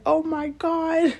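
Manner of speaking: hearing the shooting news, a stunned high cry; shocked cry. Retold or imitated. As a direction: gasp it out in disbelief. Friend just shot a man.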